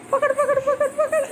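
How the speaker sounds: very high, repeated excited calls; excited urging. Real, not acted. Urging child to catch toy.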